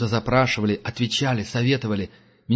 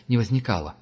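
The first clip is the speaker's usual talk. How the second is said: plain reading voice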